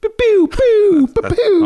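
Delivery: very high, loud pew pew laser noise; high shrill sci-fi laser pew; laser sound. Shown as a demonstration. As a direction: zap out squeaky laser blasts. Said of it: "you do an amazing laser pulse sound".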